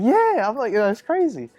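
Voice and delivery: high, animated retold outrage; worked-up confirmation. Teen telling his brother about mom, worked up.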